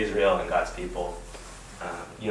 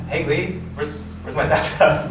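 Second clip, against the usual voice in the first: high, loud call to a friend; mock insistent demand; 8 semitones higher, 6 dB louder than usual. Kid asking friend for his card back, insistent. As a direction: act out a kid pressing for an answer.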